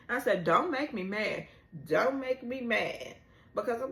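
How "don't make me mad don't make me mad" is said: louder, firm, repeated warning; mock warning. Fan warning the character, loud and stern. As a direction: scold him like a fed-up friend.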